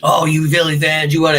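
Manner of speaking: mocking loud rival fan; mock weirdo heckler. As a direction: imitate an aggressive heckler. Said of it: "that weirdo, like, Trumpy energy where they're like".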